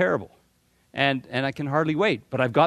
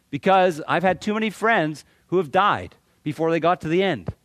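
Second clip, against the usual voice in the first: ordinary delivery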